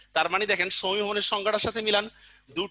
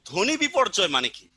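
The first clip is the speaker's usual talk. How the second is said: normal talking voice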